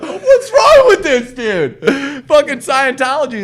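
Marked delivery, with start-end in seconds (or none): 0.0-1.8 high, loud, slow wail through laughter; laughing mock cry. As the spider pops back up, laughing mock wail. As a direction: wail it like a terrified kid, laughing. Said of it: "You're like".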